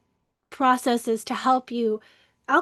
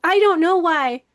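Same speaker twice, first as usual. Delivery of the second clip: higher, louder protesting answer; exasperated retold reply; 4 semitones higher, 6 dB louder than usual. Daughter retelling her answer to crying mom, exasperated. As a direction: throw the answer back, exasperated.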